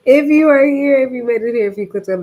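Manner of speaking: bright, loud enthusiastic greeting; rally the viewers; excited welcome. Real, not acted.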